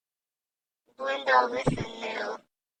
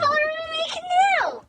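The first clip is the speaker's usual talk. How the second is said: whiny shout; 13 semitones higher, faster than usual. Real, not acted. Can't follow the painter's colors.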